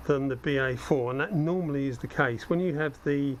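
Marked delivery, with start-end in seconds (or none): none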